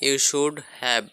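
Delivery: slow, spelled-out English for learners; slow model phrase; slow teaching model. Shown as a demonstration. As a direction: say each word slowly so students can copy. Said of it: "এটা বলবো এইভাবে".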